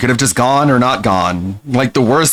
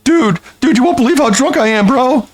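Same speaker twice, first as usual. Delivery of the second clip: high mocking voice of a tipsy teenager; drunk teen mockery; 12 semitones higher than usual. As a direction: brag like a kid after one beer. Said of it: "what are you, fifteen?".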